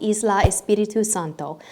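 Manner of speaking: put-on mild Spanish accent on the island name. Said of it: "my Spanish accent".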